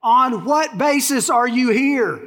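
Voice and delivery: slow, high-pitched, insistent questioning; acted baffled official losing patience; exasperated supervisor. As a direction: demand it word by word. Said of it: "eventually he says".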